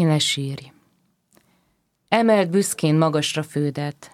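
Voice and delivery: ordinary talk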